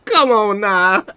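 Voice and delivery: high, loud, mid-laughing fit; laughing disbelief. Real, not acted. Streamer to a naive chatter, loud laughing disbelief. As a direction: call it out between cackles.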